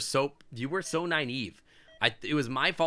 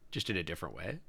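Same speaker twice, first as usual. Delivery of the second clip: sly aside; 8 semitones lower, 10 dB quieter, faster than usual. Real, not acted. Innuendo muttered under breath.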